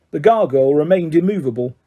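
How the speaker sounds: plain reading voice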